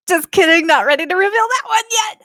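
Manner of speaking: very high, loud mock retraction; joking squeal. Co-host teasingly pulls back an announcement, high joking squeal.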